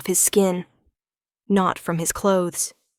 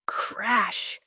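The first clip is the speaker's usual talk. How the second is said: breathy whispered sound word; 8 dB quieter than usual. A narrator's reading. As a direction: whisper the crash.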